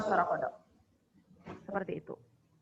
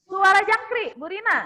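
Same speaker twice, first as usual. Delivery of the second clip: calling out; 9 semitones higher, 5 dB louder than usual. Real, not acted. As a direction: call it out like a game host. Calling out animal sound turns.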